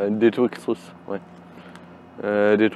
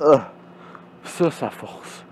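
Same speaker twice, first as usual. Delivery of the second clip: strained whisper; 5 semitones higher, slower than usual. Real, not acted. Strained remark during abs exercise.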